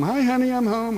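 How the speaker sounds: high cheery dad calling out; mock cheerful dad announcing himself; sitcom dad. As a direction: sing out like a sitcom dad coming home. Dad comes home in movie.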